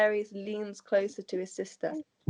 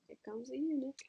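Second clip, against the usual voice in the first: unvoiced whispered aside; hushed joking aside; 4 semitones higher, 10 dB quieter, faster than usual. Real, not acted. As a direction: whisper it to the table like a joke.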